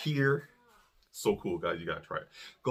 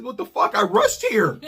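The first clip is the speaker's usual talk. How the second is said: high, louder disbelief; incredulous outburst; 11 semitones higher, 4 dB louder than usual. Retold or imitated. Husband shocked his wife is still doing laundry. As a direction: throw it out in shock.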